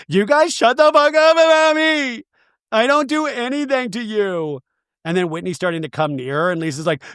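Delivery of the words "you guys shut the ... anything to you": high, drawn-out wailing imitation; mock tearful screaming. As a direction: wail it at the table, hammed-up and loud. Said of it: "pivot this way to tears".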